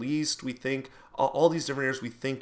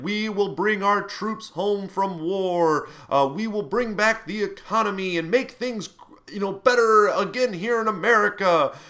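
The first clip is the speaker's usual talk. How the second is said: campaign speech; 8 semitones higher, 6 dB louder than usual. Retold or imitated. Imitating a nationalist politician.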